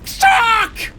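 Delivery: squealing hope turning to despair; joy collapsing into a curse; high excited then dismayed. Real, not acted. End reached then lost.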